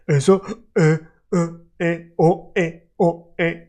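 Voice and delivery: Eso broken into eh, o, eh stops; choppy separate vowels. Shown as a demonstration. Teacher showing the wrong way to link Eso es, choppy. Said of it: "I don't want you to say".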